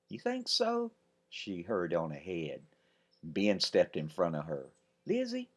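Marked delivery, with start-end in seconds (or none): 0.1-0.9 high, light doubtful; a touch coy; lightly flattered; coy reply. Acted. Elizabeth coy. 5.0-5.5 put-on light anxious boy; small boy asking. Ben anxious.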